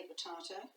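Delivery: "potato" with an AH in the middle; disowned pronunciation. Shown as a demonstration. Teacher denying she talks that way, po-TAH-to. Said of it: "I do not say potato".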